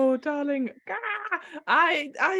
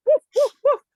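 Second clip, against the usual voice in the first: loud higher barking woofs; dog barks; 7 semitones higher, 7 dB louder than usual. Acted. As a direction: bark like an excited dog. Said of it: "random animal sounds".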